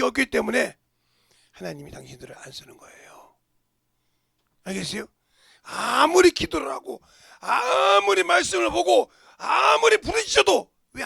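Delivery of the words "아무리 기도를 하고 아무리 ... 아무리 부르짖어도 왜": loud, high, slow building cry; preaching shout. Real, not acted. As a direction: hammer each phrase, slowly. Preacher climaxes on prayer.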